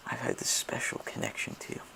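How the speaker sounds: low unvoiced whisper; hushed intimate confession. Confessing love to client.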